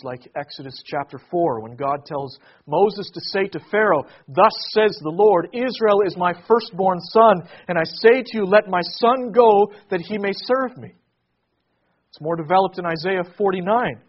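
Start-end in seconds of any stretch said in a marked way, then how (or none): 4.3-11.0 raised, louder scripture proclamation; preaching at force. Acted. Pastor quoting Exodus to the congregation, proclaiming loudly. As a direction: proclaim the Lord's words to the congregation.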